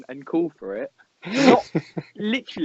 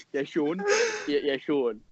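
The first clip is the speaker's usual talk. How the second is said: mock calling for pass; 14 semitones higher than usual. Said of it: "Charlie standing there going".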